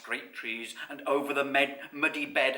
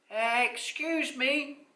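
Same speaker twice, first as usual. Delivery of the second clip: very slow, high little voice; tiny drawn-out creature voice; small voice; 14 semitones higher, 4 dB louder, much slower than usual. A narrator's reading. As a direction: politely squeak for attention. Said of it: "in a small voice".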